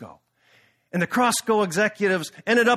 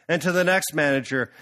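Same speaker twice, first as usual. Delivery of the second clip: ordinary delivery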